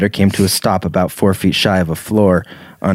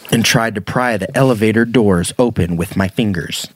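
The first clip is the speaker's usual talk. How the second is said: ordinary talk, nothing marked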